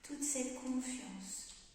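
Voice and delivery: slow, fading to a whisper; guided meditation. Acted. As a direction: breathe it out softly, slowly. Guides breathing exercise calmly.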